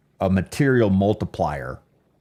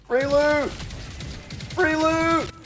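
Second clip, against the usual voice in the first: very high robotic sing-song call; auto-tune yell; slower than usual. Pretend. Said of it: "Yeah, auto detune".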